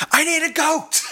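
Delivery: high, thin wizard shout; wizard yelling. Retold or imitated. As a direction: yell it as a frantic wizard. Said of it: "And then I'm like".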